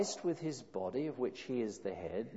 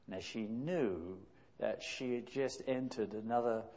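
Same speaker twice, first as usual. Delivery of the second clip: ordinary delivery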